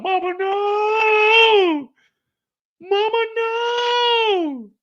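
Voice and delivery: high, very slow mock crying wail; high drawn-out fake sobbing plea; hammed-up wailing. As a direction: wail it like a soap opera. Kane crying for mama.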